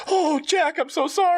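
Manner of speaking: high, pleading apology; whiny sorry voice. Acted. Son apologizing to the mannequin, high and pleading. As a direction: squeak out a sorry to the doll. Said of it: "then he gets like".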